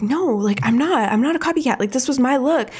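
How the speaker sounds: indignant, put-on protest; upset protest. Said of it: "he's, like, really upset by this".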